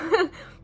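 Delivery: short wet laugh through tears; teary laugh. Real, not acted. Laughing through tears mid-confession. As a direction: laugh while crying.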